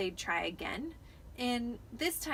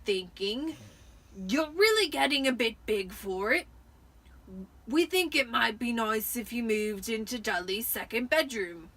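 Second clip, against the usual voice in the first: forced nice uncle; 5 dB louder than usual. A narrator's reading. Vernon forcing a smile, uncle voice.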